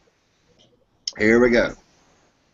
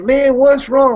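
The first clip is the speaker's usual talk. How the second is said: a much higher, louder worried "what's wrong"; high concerned call; 12 semitones higher, 6 dB louder, slower than usual. Retold or imitated. Hunter calling to his sobbing friend in heaven, high and alarmed. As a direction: call out to the crying buddy, pitched way up.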